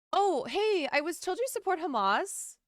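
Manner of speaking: fake-friendly chirpy casual tone; mock casual. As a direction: say it like small talk, lightly. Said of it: "Saying it so casual".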